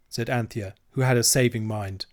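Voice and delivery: ordinary delivery, nothing marked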